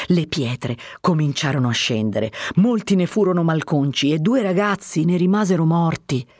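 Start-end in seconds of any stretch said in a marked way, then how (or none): none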